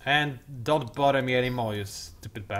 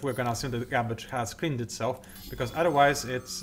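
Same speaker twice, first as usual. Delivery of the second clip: ordinary delivery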